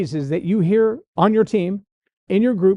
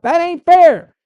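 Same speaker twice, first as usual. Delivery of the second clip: much higher and louder, an outraged yell; yelled protest; 10 semitones higher, 9 dB louder, slower than usual. Retold or imitated. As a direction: shout it at the whole team. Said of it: "one person yells out".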